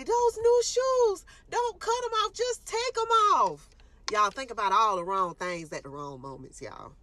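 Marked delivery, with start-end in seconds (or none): 0.0-3.7 very high, loud and slow panicked wail; patient begging the nurse not to cut her shoes; frantic plea. Retold or imitated. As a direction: wail it out to save your new shoes. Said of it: "I'm stressing to the lady".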